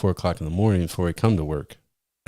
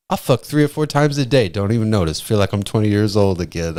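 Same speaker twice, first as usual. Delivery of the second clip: imitating Vel bragging; 3 semitones higher than usual. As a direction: brag like the coworker. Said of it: "He's like".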